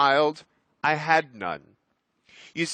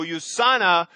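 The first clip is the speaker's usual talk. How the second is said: ordinary talk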